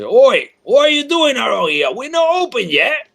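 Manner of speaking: high, loud barker's call; loud carnival barker. Acted. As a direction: bellow it like a carnival barker working the crowd. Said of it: "That's why I speak so loud".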